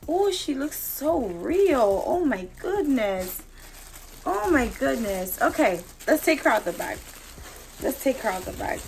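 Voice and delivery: usual speaking voice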